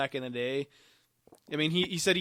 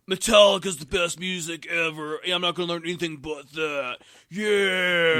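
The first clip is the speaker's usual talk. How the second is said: put-on loud, cocky metalhead kid; cocky teen voice; 4 semitones higher, 8 dB louder than usual. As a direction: brag like a stubborn teenager. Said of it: "I was more like".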